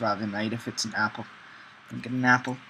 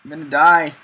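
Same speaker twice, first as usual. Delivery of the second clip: louder, half-joking alarm; mock panic; 3 semitones higher, 10 dB louder than usual. Real, not acted. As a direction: cry out in playful dread. Starving in game.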